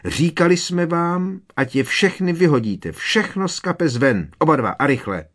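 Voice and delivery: every word a reproach to the twins; mother rages at the twins; furious mother. A narrator's reading. Mother keeps scolding.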